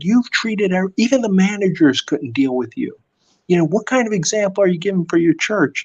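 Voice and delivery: lightly voiced lecture to the customer; Bob scolding. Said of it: "like, saying, like".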